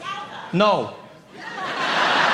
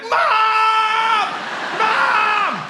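high shouted call for mom; 3 dB louder than usual. Retold or imitated. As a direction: yell for mom across the house, high. Kid calling mom to TV.

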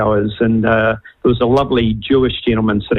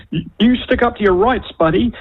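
retold mock yell; 8 semitones higher than usual. Half the plane backing him, mock screaming. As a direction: imitate heckling passengers with raised pitch. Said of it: "half the plane are screaming".

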